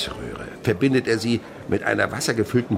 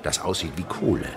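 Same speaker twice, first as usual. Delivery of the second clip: ordinary talk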